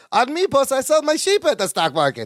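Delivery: high-pitched comic imitation of a sitcom character; Balki. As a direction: imitate him high and accented, close to laughing. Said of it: "And Balki goes".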